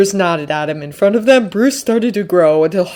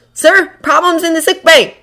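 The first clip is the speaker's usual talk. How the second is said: urgent report; 11 semitones higher, 5 dB louder than usual. A narrator's reading.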